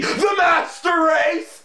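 high-pitched, drawn-out hype shout; over-the-top fanfare cry; mock-grand proclamation. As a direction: proclaim it slowly in a high, hammed-up yell. Mock-grand cheer for PC gaming.

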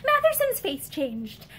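ordinary delivery, nothing marked

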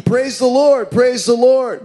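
shouting praise. Retold or imitated. Old lady finding groceries on her porch, joyful high shout. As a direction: shout it out with delight.

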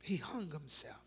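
hushed, breathy grim reveal; whisper. Real, not acted. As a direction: drop to a whisper for the death.

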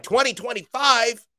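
high, disbelieving mock fan; mock impatient fan. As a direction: squeal the year like a fan who can't wait. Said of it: "some of you are like".

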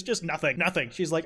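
ordinary talk

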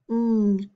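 NG as one held nasal hum; isolated digraph sound. Shown as a demonstration. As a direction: sound out "ng" without naming letters.

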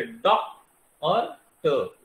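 each piece, डॉक and ट, as its own beat; separate syllables. Shown as a demonstration. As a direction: slowly set डॉक apart from ट. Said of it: "बोल के देख लीजिए".

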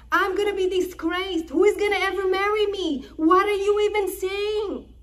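high, loud, anguished protest; young woman crying out in loud, high distress against her brother; Tamar pleading outcry. Acted. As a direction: cry out in horrified refusal, pitch way up. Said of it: "And Tamar is saying to him".

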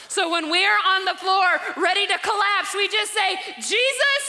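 preacher calling on Jesus from the floor; fervent preaching prayer. Acted. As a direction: pray it out loud to the congregation.

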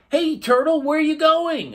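high, loud calling voice; pufferfish voice. A narrator's reading. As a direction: call out high and bright. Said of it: "asked the pufferfish".

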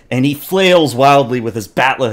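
usual speaking voice

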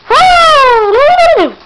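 very high, loud cheer; real thrilled woo; excited whoop. Real, not acted. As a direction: let out a big whoop. Cheering a jump.

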